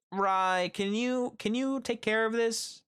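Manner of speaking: high-pitched mock coworker voice; coworker pleading. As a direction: imitate a coworker asking a favor, way up high. Voices coworker asking a favor.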